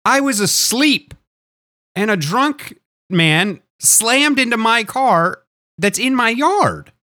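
high, slow, indignant protest; indignant high outburst. Retold or imitated. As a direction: refuse it with high outrage. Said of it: "I'm like".

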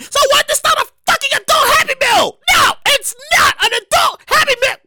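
real exasperated outburst; indignant rant. Real, not acted. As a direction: shout it down like a scam. Ranting against McDonald's.

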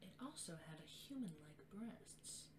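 a hint of a psycho killer's calm.

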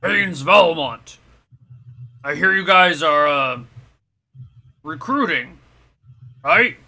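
a hint of a deep rumbling giant; lightly voiced rumbling big fella. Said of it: "you kinda hear this deep rumbling voice".

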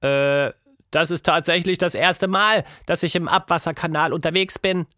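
a hint of a curious girl. Tilda voiced as a girl.

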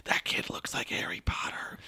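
soft unvoiced whisper. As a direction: breathe it like a passing tourist. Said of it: "the echoing whispers".

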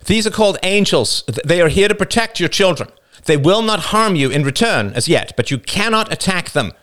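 high, emphatic announcement; David, firm warning. A narrator's reading. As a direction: warn the whole crowd. David warns the tribe.

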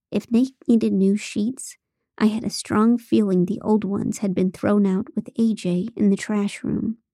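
plain reading voice

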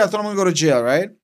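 ordinary talk

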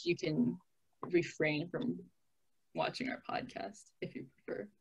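soft fast aside; quiet aside. Real, not acted. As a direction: rush it under your breath. Quick aside.

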